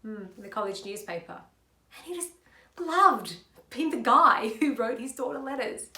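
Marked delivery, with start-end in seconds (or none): 2.0-5.9 emphatic delivery. Shown as a demonstration. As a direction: belt out LOVED, letting a laugh in. Same monologue, projected second take.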